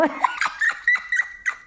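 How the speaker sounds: airy high scornful laugh; high mocking laugh. Real, not acted. Vlogger scoffs at her rival, airy high laugh.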